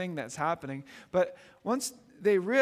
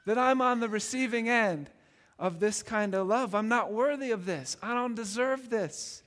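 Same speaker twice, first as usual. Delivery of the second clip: awed cry; 3 semitones higher than usual. Retold or imitated. Believer crying out to God, overwhelmed with grateful wonder. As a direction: marvel that you are accepted. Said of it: "And we cry out".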